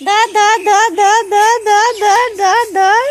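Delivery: loud, high repeated urging; excited calling. Real, not acted. Urging toddler to grab.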